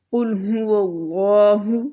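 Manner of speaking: कुल हुवल्लाहु with a clear, breathy throat ha before हु; slow chant, voice the ह from the throat. Shown as a demonstration. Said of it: "इस हा को बहुत अच्छे से अदा करना है". (कुल हुवल्लाहु में हलक से साफ़ हा)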